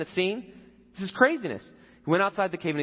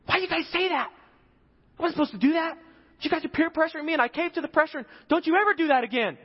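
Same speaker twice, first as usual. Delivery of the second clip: much higher, louder mock telling-off; scolding his men; 13 semitones higher, 4 dB louder, faster than usual. David rebuking his men for the pressure, high mock scolding. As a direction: scold the friends who egged you on. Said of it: "He turns around and is like".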